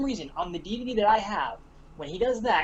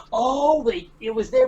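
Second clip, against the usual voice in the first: louder, higher surprise; sudden realization; 5 semitones higher, 7 dB louder, slower than usual. Retold or imitated. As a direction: suddenly get it. Discovery quote.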